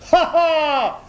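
high, loud mocking ha ha; blacksmith's jeering laugh; bursting laugh. A narrator's reading. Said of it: "en éclatant de rire".

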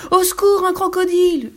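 loud, very high cry of alarm; screaming for help. A narrator's reading. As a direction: shriek for help at a sudden danger. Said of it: "hurla Lou". (hurler au secours, voix suraiguë)

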